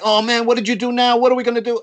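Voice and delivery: half-hearted panicked shout; mock yell. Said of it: "started yelling at me like".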